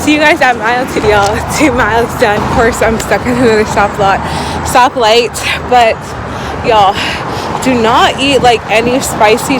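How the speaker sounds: breathless running talk; talking mid-jog, winded; while running. Real, not acted. Talking mid-run between miles.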